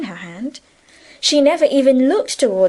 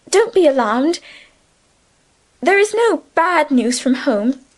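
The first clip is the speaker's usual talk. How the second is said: lightly voiced, gently reassuring; a hint of calm comfort; reassuring young woman; 6 semitones higher than usual. A narrator's reading. Emily breaks news gently.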